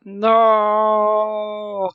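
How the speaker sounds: loud anguished wail; loud protesting cry. Acted. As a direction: wail it like an animal. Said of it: "uttered a cry of protest".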